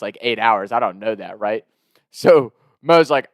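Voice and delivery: ordinary talk, nothing marked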